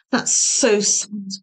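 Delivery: usual speaking voice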